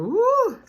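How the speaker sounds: high delighted whoop; loud happy woo; excited whoop. Real, not acted. Reacting to tender steak.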